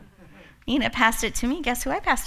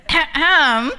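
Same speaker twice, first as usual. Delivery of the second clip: fake throat clear; 4 semitones higher, 8 dB louder than usual. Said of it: "And I went".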